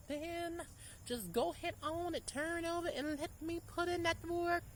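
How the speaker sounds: high, teasing come-on voice; sultry command. Retold or imitated. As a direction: purr it like a playful order. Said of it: "Honey, you don't have to ask".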